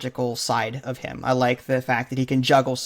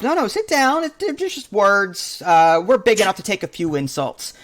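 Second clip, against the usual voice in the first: Scotty calming Chekhov, lightly voiced reassurance; lightly imitating Scotty; 5 semitones higher than usual. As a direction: settle a shipmate down gently. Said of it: "telling Chekhov".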